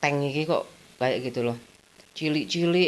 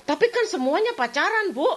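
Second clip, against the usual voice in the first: much higher, sulky pleading; whiny teen protest; 3 dB louder than usual. Retold or imitated. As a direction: argue back like a sulky daughter. Streit mit Mutter.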